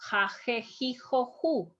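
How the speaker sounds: "ja, je, ji, jo, ju" said ha, heh, hee, ho, hoo; Spanish J syllables. Shown as a demonstration. Teacher drills students on the vowels, Spanish J as H. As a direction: recite "ja, je, ji, jo, ju" with each J as H. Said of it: "is the way that we pronounce".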